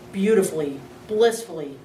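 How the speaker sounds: ordinary delivery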